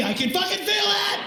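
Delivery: high, loud laughing shout; hammed-up outraged shout; mock yell. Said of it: "him telling me".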